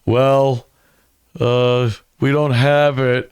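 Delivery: halting, hesitant impression of a colleague; Garrett. Garrett answering about the missing camera, lightly imitated hesitant stalling. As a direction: lightly imitate him stalling between phrases. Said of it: "it's a great Garrett impression".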